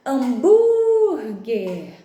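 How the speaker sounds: "Hambúrguer" called out loud with a very long BÚR; calling the word. Shown as a demonstration. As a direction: call "Hambúrguer" as if summoning it, stretching BÚR. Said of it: "chama a palavrinha". (chamando "Hambúrguer" em voz alta, com o BÚR esticado)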